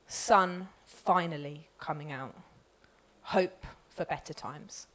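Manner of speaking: gentle poem close. Acted. Poet closes the poem for the audience, soft and low. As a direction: ease down gently into hope. Poem's hopeful ending.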